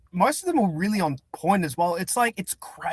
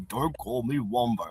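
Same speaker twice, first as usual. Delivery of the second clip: lower, softer grumpy wombat; imitating Wombo; 5 semitones lower, 5 dB quieter than usual. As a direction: growl it low like a cranky wombat. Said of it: "he did the Wombo voice".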